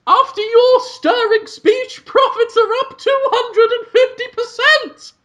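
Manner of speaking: very high, loud falsetto character voice; Roseanne Barr. Acted. Employee reporting profits to the salesmen, shrieking falsetto. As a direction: squeal it in a drawn-out falsetto. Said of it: "Roseanne's Bar character answers it".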